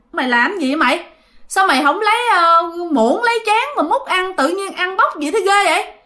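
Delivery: high-pitched scolding at the little sister; scolding yell. Retold or imitated. Older sister scolding the younger one for eating rice by hand, high scolding. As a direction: scold her sharply for eating with bare hands. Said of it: "Bạn mới la".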